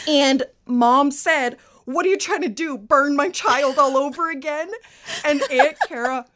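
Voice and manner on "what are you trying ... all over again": sharp, higher scolding mother; angry scolding. Retold or imitated. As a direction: scold her like an outraged mom. Mom scolding aunt in retold story.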